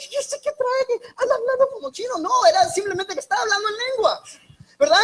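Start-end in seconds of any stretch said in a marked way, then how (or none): 0.1-2.2 higher, baffled newcomer voice; mock puzzled newcomer. Pastor voices a bewildered newcomer hearing tongues, higher puzzled voice. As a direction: sound confused, like hearing nonsense.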